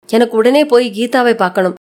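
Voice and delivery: plain reading voice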